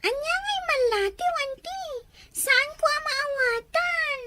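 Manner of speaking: crying questions, slowed at the end; tearful child. Acted. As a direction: ask through tears, lost and hurt. Child confused about missing father.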